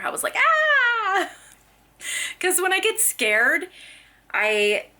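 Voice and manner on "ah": short high startled scream "Ah"; retold scream. Mom reenacting her scare at the toddler, high startled yelp. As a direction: yelp like you just got spooked. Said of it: "I actually screamed at her".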